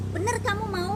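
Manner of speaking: loud, high, pressing question; insistent checking. Retold or imitated. Narrator asks the woman to confirm her decision, insistent. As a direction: press hard to make sure. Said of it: "nanya tiga kali untuk meyakinkan".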